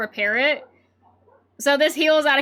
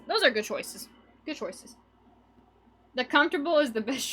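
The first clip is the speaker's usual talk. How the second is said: normal talking voice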